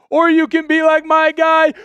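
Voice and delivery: loud, high, stretched name call; hype shout-out. Real, not acted. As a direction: call his name across the arena. Calling out a chat member.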